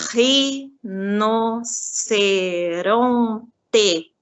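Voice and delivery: RINOCERONTE very slowly as RI... NO... CE... RON... TE; teacher counting out each syllable of "rinoceronte". Shown as a demonstration. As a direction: drag "rinoceronte" out into five separate, stretched syllables. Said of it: "eu conto as sílabas".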